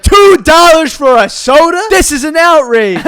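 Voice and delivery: huffy customer, hammed-up and slow; mock outrage. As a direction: ham up a customer's outrage at the price. Customer fumes over soda price.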